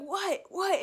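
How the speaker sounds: high-pitched, retold disbelief; startled reaction. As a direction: act out a startled double-take. Acting out her startled reaction to niece.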